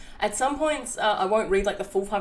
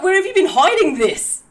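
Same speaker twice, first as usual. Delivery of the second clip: high, loud delighted squeal; high, loud, thrilled mock gasp; excited squeal; 12 semitones higher, 13 dB louder than usual. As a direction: gush it like a thrilled fan. Excited reaction to the story.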